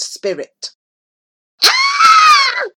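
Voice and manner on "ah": very high, loud scream of despair; long, full-force anguished scream; piercing shriek. A narrator's reading. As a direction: shriek with your whole being. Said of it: "shrieked Remus, with his whole being".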